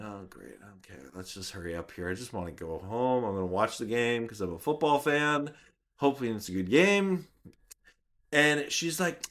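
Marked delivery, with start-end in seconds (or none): 0.0-7.3 muttered inner thought. Retold or imitated.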